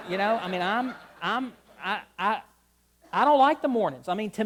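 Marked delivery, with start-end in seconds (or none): 3.1-4.1 DON'T punched hard and loud, said much louder; emphatic, louder. Real, not acted. Pastor repeating his dislike of mornings, emphatic and louder. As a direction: really lean on "don't" with a louder voice.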